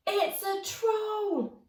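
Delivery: high, slow scared cry; frightened exclaim. Acted. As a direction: gasp it out at the sight of a troll. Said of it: "exclaimed George".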